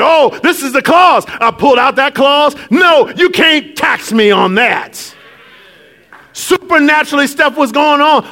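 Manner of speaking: high, loud, emphatic preaching; fiery preaching. Acted. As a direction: preach it out with conviction. Preaching at full force.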